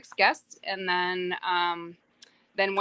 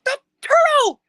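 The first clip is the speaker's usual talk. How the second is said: much higher and louder, an amazed shout; excited kid's shout; 11 dB louder than usual. Retold or imitated. Son surfacing while snorkelling, shouting to mom in amazement. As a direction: yell it like a boy who just saw something huge. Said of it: "He goes".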